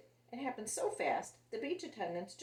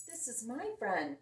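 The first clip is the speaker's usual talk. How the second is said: usual speaking voice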